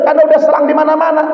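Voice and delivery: high and fast, brushing it off; doctor explaining fast. Retold or imitated. Doctor explains the tubes everywhere, quick and high. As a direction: rattle off the reason quickly. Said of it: "kata dokter".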